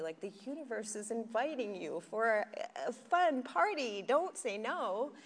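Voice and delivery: playful urging. Acted. Urging listeners to say yes.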